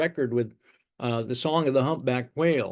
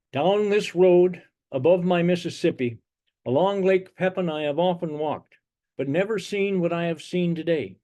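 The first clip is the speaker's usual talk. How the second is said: higher, sustained verse-reading voice; poem recitation; 4 semitones higher than usual. Acted. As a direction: recite in measured phrases, holding the pitch up. Recites his vision poem.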